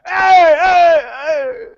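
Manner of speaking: high, excited hammed-up crowd voice; cartoonish public, high and gushing; mock cheering public. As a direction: cheer like a gleeful fan who feels vindicated. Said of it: "the American public will be like".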